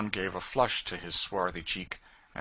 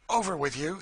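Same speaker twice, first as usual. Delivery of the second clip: a loud, high call to jump; 10 semitones higher, 4 dB louder than usual. A narrator's reading. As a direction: Phineas urging the fugitives across the chasm, calling out. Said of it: "he called".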